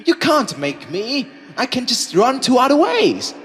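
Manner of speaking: defiant shout. Acted. Defiant player shouting at captor.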